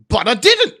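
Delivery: high, loud acted denial; indignant protest. Driver denies the prosecution's drug claim, indignant. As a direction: protest your innocence indignantly.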